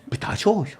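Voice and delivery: whispered. Real, not acted. Whispering back.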